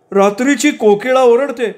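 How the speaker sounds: high, forceful declaration; insistent character voice. A narrator's reading. Man arguing about birds at night, high insistence.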